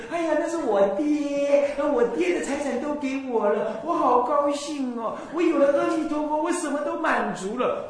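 mock a silly, giddy believer; giddy gushing woman. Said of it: "像小女人三三八八的那种心情". (法师模仿浅薄信徒，故作娇嗔欢喜的腔调)

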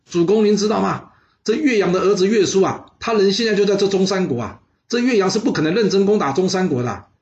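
high, urgent courtiers; alarmed warning. Acted. Courtiers warn their lord about the son, high and urgent.

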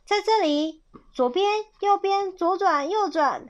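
high-pitched quick calls; animated misleading calls; calling out directions. Acted. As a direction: toss out directions like distant shouts.

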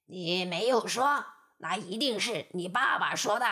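low, mildly fierce wolf voice; a wolf out of excuses snarling. Acted. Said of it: "只好恶狠狠地说". (恶狠狠的狼)

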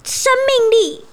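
high, over-the-top praise; gushing friend. Acted. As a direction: gush about the card. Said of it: "他朋友就说". (朋友夸赞卡片，夸张地称赞)

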